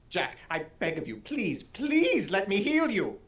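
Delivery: pleading healer. Acted. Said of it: "you need to sound stabbed".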